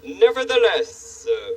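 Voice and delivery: slow, stately royal voice; pompous king. Acted. King Friday gently scolding the handyman, slow stately royal voice. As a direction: lecture like a grand, fussy monarch.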